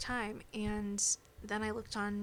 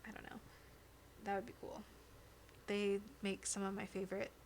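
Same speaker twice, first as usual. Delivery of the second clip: usual speaking voice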